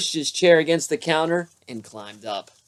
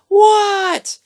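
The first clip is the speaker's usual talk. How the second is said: little frog's high reply; 6 dB louder than usual. Acted. Froggy answers his father.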